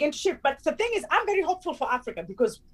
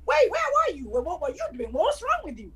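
mock indignant confusion; 5 semitones higher than usual. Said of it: "we come at each other like".

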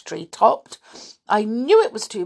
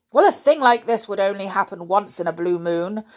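louder, irritated outburst; getting angry; 2 semitones higher, 7 dB louder than usual. Acted. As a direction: snap back in a flare of temper. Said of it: "cried Unc-, Uncle Quentin, getting angry".

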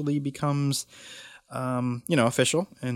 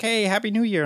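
higher-pitched mock cheery greeting; 12 semitones higher, 3 dB louder than usual. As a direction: imitate an upbeat New Year well-wisher. Acting out coworker's new year greeting.

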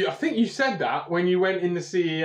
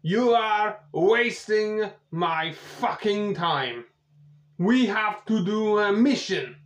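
slow, heavy gruff Russian henchman; a slow menacing Russian heavy; Russian specialist; 4 semitones higher, slower than usual. Acted. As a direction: growl it out slowly as an impatient tough guy. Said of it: "and he goes".

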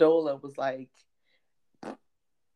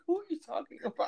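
mock confusion; 13 semitones higher, 4 dB quieter, faster than usual. Confused reply to friend.

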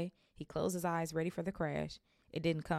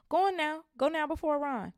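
much higher, louder urging; urging mother; 11 semitones higher, 7 dB louder than usual. Acted. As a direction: hurry him on.

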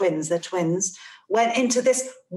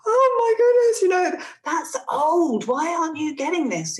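high, exasperated inner cry; a parent's inner panic, pitched high; 9 semitones higher than usual. Retold or imitated. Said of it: "inside as a parent, was like".

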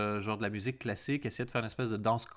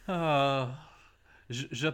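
slow, breathless from laughing; after laughing hard; 4 semitones higher, much slower than usual. Real, not acted. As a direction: catch your breath after crying with laughter. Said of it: "je pleure de rire".

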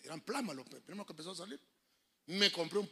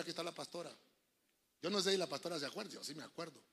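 normal talking voice

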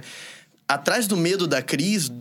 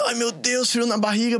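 higher-pitched retold little fear; mock stage fright; 6 semitones higher than usual. Speaker voicing someone about to dance at school, mildly nervous. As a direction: imitate pre-show nerves, lightly. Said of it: "dá aquele medinho".

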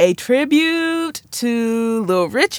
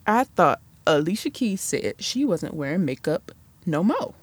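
much softer muttered aside; muttered repeat; 4 semitones lower, 7 dB quieter than usual. Shown as a demonstration. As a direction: mutter it again under your breath. Same line repeated softly.

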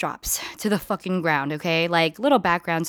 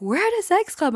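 amazed; 11 semitones higher than usual. Retold or imitated. Friend's amazed remark.

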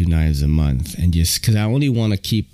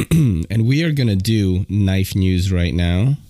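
ordinary delivery

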